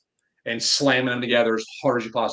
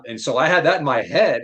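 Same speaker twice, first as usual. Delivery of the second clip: usual speaking voice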